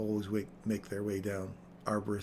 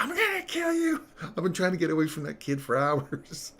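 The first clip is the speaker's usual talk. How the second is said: very high, loud squeaky cat threat; cartoon cat screech settling to high rant; angry cat voice; 7 semitones higher, 6 dB louder than usual. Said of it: "this cat's like".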